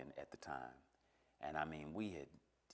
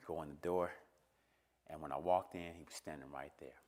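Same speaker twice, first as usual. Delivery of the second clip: ordinary talk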